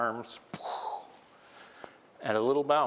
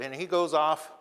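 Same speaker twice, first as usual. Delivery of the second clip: ordinary delivery, nothing marked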